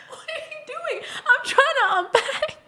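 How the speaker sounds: high, laughing exasperation; a high laughing plea to the neighbor; laughing disbelief. Retold or imitated. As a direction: ask through laughter, baffled. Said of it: "And I'm like".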